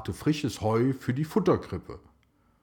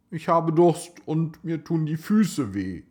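put-on a higher, louder whiny girl's complaint; whining child; 7 semitones higher, 4 dB louder than usual. A narrator's reading. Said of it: "jammerte Sarah".

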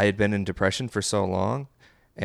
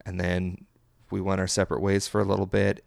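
ordinary talk, nothing marked

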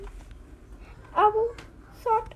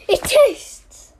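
louder, punchy It tastes; 9 dB louder than usual. Pretend. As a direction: say It tastes out loud and firm. Pondering tea taste.

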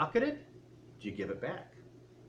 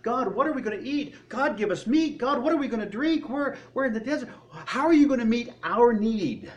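higher, whining, demanding questions; grumbling people in the desert, impatient; complaining to God; 7 semitones higher than usual. Retold or imitated. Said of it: "You hear them complaining about".

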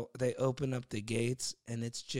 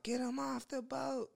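retold freak-out; 13 semitones higher, 4 dB quieter than usual. Dude orders people off the overcrowded boat, freaking out. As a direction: imitate a dude freaking out, pitched way up. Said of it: "dude was freaking out".

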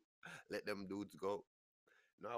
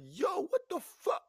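very high, loud, stretched-out alarm; hammed-up panicked shriek; shocked yell; 11 dB louder, slower than usual. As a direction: holler in total disbelief. Acted shock while driving.

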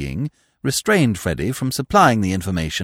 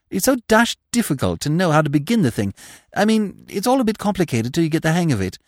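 put-on quick, higher, fumbling young man; a nervous young man explaining his scheme, voiced fast and higher; Freddy flustered; 8 semitones higher, faster than usual. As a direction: rush it out, embarrassed.

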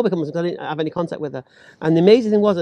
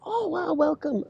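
higher, gushing welcome; friendly strangers; 10 semitones higher, 7 dB quieter, slower than usual. Retold or imitated. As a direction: greet him like delighted hosts. Voicing friendly strangers at fair.

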